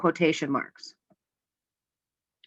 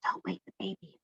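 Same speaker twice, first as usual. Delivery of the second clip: hushed, very soft whisper; 14 dB quieter than usual. Acted. Someone warning not to wake the baby, whispering. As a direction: whisper it so nobody stirs. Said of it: "She whispered".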